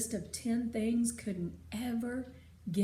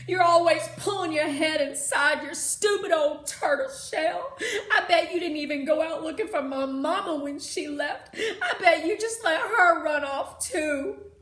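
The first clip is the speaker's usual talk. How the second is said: much louder, higher tirade; angry shouting; 6 semitones higher, 12 dB louder than usual. Acted. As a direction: rage at your father. Girl yelling at her father.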